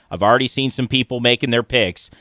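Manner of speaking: ordinary delivery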